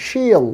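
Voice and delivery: she'll drawn out high, falling, as "sheeel"; elongated vowel. Shown as a demonstration. Teacher shows how to elongate it, long vowel. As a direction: stretch the ee in she'll. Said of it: "একটু elongated করতে হবে".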